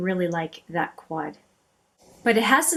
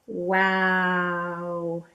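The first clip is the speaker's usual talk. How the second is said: long, drawn-out wow; lingering, level-pitched amazement; awed. Real, not acted. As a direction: stretch it out in real admiration. Awed by the swatched colour.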